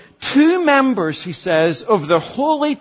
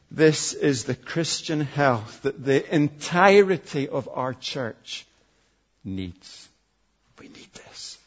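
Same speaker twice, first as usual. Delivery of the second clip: softer and lower until the last words are only breathed; fading to a whisper; 8 semitones lower, 9 dB quieter than usual. Acted. Preacher pleading with his church, fading to a whisper. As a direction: draw them in, fading to a whisper.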